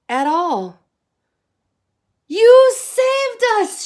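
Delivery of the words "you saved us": very high, loud, slow cry; joyful shout. A narrator's reading. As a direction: burst out in grateful joy. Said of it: "shouted the frogs".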